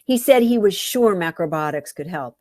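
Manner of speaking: plain reading voice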